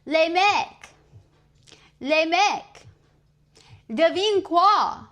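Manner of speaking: high, loud "les mecs, les mecs", slow "devine quoi"; excited announcement. Retold or imitated. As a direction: call the friends in, bursting with news. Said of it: "I'm gonna be like".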